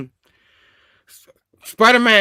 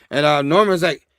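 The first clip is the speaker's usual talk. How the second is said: normal talking voice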